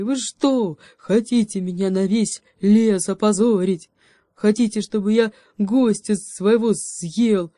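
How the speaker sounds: rant, barely holding back; bear ranting. A narrator's reading. Bear shouting at the forest animals, furious rant.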